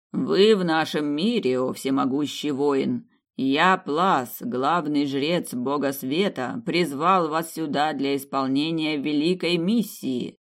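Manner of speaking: slow, loud grandiose proclamation; pompous old priest. Acted. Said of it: "высокопарно вещал бородатый дедок".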